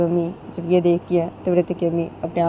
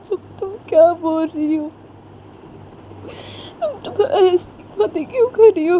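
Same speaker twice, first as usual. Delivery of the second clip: much higher pleading mother's voice; 11 semitones higher than usual. Acted. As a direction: beg your daughter to stop. Mother begs daughter, high pitched. (बेटी से गिड़गिड़ाती माँ, बहुत ऊँची आवाज़)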